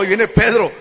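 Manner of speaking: ordinary talk, nothing marked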